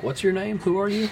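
high, puzzled questioning voice; confused question. Retold or imitated. Confused parents, acted quote.

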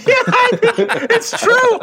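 high, laughing admission, drawn out. Real, not acted. Host caught out by a co-host's jab, laughing high. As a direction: laugh through the words.

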